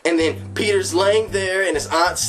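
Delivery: high, slow, building movie-climax telling; dramatic trailer narration. Acted. Describing the film's explosive climax as a slow, dramatic narration. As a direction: paint the big finale slowly.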